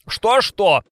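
high, loud mocking echo; mocking mimicry. A narrator's reading. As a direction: mimic her to ridicule her. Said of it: "глумливо передразнивала Варвара".

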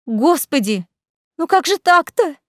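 high-pitched little cry of dismay; dismayed squeak. A narrator's reading. Ника sees Саид's photos, squeaking in dismay. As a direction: squeal it in alarm. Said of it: "пискнула Ника".

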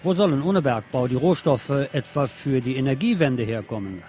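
usual speaking voice

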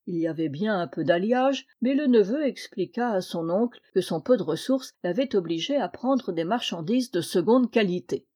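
plain reading voice

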